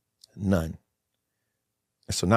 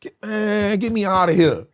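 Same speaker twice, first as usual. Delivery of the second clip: higher, desperate plea to get out; higher, strained plea from a prison cell; pleading; 8 semitones higher than usual. Retold or imitated. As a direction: beg to be let out. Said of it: "I'm like".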